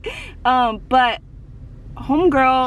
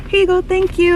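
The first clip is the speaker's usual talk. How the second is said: high, louder chirpy idol voice; imitated idol, pitched up; cheerful idol; 8 semitones higher, 4 dB louder than usual. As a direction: chirp it handing something back. Imitating idol handing album back.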